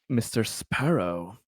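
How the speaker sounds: normal talking voice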